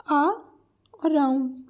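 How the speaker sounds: "आ", a pause, then "round"; split into syllables. Shown as a demonstration. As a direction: break the word after "आ". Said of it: "around में कितने syllables हैं".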